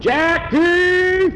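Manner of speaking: loud high call across the farm. Acted. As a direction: call his name from far off. Farmer calls boy from afar.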